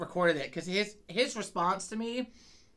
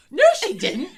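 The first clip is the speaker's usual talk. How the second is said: high, loud flat denial; husband refusing to believe his wife; incredulous husband; 11 semitones higher, 12 dB louder than usual. Retold or imitated. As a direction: deny it with wide eyes. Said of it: "He's like".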